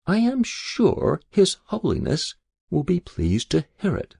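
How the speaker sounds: high-pitched, polite servant's voice; deferential keeper. A narrator's reading. Keeper to his angler after a catch, deferential.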